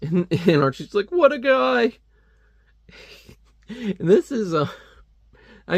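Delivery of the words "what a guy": high, light mock hero voice; Archie voice. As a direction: toss it off like a carefree cartoon teen. Archie's reaction voiced in comic.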